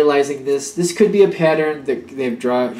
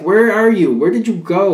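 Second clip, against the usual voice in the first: higher and louder, exasperated calling out; frustrated demand; 7 semitones higher, 6 dB louder than usual. Retold or imitated. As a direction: press them, fed up that they drifted off. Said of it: "with a moment of frustration".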